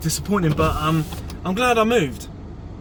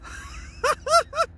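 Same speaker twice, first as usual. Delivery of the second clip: delighted high laugh; 3 dB quieter than usual. Real, not acted. Laughing at fish catch.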